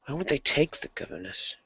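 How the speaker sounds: soft, puzzled muttering to oneself; muttering aloud. Acted. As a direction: think aloud under your breath. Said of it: "gonna mutter aloud".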